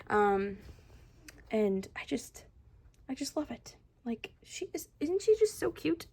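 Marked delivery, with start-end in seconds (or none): 4.4-6.0 cutesy coo. Real, not acted. Cooing over cute doll.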